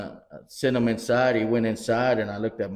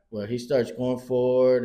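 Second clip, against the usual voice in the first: normal talking voice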